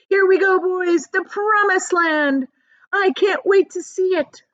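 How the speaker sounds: high, loud whoop; excited shouts. Acted. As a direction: shout with joy at the promised land. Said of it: "shouts of".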